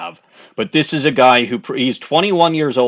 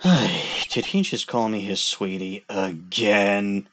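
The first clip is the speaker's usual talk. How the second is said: ordinary talk, nothing marked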